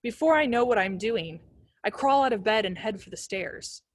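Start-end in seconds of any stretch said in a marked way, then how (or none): none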